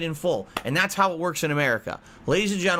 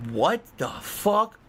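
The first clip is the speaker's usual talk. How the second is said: stunned reaction; 4 semitones higher, much slower than usual. Retold or imitated. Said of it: "I'm like".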